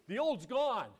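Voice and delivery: high, loud pulpit exclamation; emphatic preaching shout. Real, not acted. Preacher declaring the old life over, emphatic shout.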